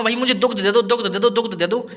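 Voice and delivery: lightly voiced villager begging, repeating "दुग्ध दे दो"; a hint of a pleading villager using the bookish word "दुग्ध"; mock plea for "दुग्ध"; mock villager asking for milk. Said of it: "बोलेगा ऐसा कोई?".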